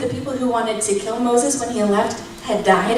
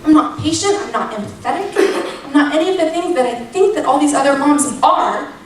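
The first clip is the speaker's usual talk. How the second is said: retold protest, higher, half laughing at herself; exasperated plea; 4 semitones higher than usual. Mom asks God why he picked her, exasperated plea. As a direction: plead upward in disbelief, faltering before the end. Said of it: "I'm dead serious in that moment".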